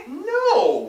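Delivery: high, loud indignant no; high loud indignant denial. A narrator's reading. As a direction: shoot it back, offended and loud. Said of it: "replied Mark with truth and indig".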